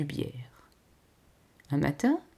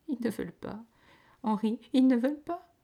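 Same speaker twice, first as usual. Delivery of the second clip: put-on higher, tearful and babyish; sobbing childlike stammer; 6 semitones higher than usual. A narrator's reading. Lady tearfully telling her husband Henri, sobbing like a child. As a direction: whimper it like a spoiled child denied. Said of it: "avec une voix pleine de sanglots, une voix d'enfant... elle balbutia".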